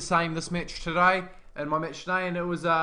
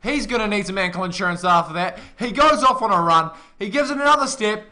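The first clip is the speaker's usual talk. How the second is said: excited; 5 semitones higher, 9 dB louder than usual. Real, not acted. Co-commentator hyped after try.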